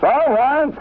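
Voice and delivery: high, put-on voice for his mother; mother's reply. Mother calling back from the kitchen, high and loud. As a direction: answer back sharply in a high pitch as mother.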